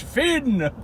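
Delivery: high, hammed-up mock sign-off; mock a grand theatrical ending; dramatic. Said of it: "he does this dramatic".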